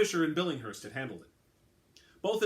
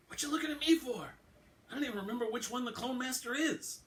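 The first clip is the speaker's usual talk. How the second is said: much higher, defensive partner voice; higher Baltimore detective brushing it off; detective Alvarado; 12 semitones higher than usual. Acted. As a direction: throw up your hands, not my problem. Alvarado voiced, high and different.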